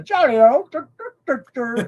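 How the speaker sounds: high, hammed-up British "Jolly old dur"; mock a jolly old English accent.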